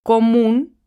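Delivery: teacher demo: común, rising stress on MÚN; stressing the tonic syllable. Shown as a demonstration. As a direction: push MÚN in común upward. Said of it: "tiene la sílaba tónica en la última sílaba".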